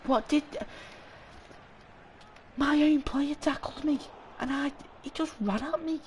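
outraged squeal. Real, not acted. Outraged when own player tackles him.

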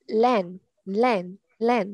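LANE short and quick. Shown as a demonstration. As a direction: say LANE fast, each one brief. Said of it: "Đọc nhanh xíu nè".

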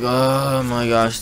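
slow, drawn-out weary "oh"; exasperated groan. Retold or imitated. Kid waiting fifteen minutes outside for the guard, exasperated. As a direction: stretch it out, fed up with waiting.

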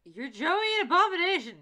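high, loud exclamation; loud protest. Real, not acted. Co-host correcting friend about who plays Joey, loud protest. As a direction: object loudly with pitch up high.

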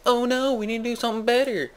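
high-pitched, hammed-up fretting; cartoon character panicking a little; mock worry. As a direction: fret in a playful high voice. Worried rival in improvised story.

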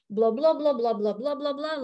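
lightly imitated droning cartoon teacher; Snoopy's teacher. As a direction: drone blah-blah as meaningless noise. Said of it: "like Snoopy's teacher".

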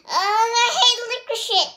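high, loud frustrated cry; frustrated outburst. A narrator's reading. Roxy trapped in licorice, loud frustrated outburst. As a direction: burst out in disgust.

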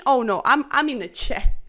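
ordinary talk

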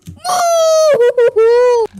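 high shrieking laughter; loud shrieking laugh. Real, not acted. Bursts out laughing.

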